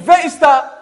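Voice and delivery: loud, high echo of the call. Pretend. The forest echo shouts the question back.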